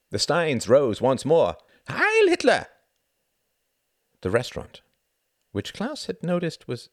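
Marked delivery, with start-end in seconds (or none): 1.9-2.7 very high, loud collective cry; cheered toast. A narrator's reading. Pilots raising steins once more, loud high cheer. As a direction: cry it out at the top of the pitch.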